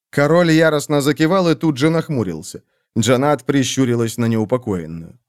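ordinary delivery

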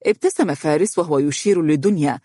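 plain reading voice

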